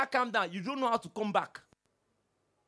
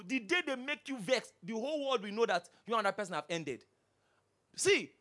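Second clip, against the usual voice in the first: normal talking voice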